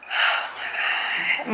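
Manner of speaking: slow breathy whisper, tense; nervous whisper. Real, not acted. Nervous waiting for text reply.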